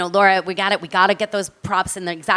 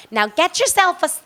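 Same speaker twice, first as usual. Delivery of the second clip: put-on New York accent; 8 semitones higher than usual. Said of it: "oh my God, I made you New York".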